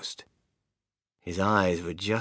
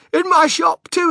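much higher, much louder yell; ecstatic yell; 10 dB louder than usual. Acted. The shopkeeper yells to the crowd, overjoyed. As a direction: shout with wild pride. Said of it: "he yelled".

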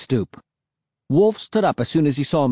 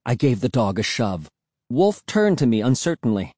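plain reading voice

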